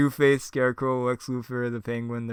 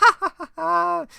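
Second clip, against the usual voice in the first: high, loud, slow ha ha ha; evil cackle; 8 dB louder than usual. Acted. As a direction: laugh like a mad clown. Joker laughs.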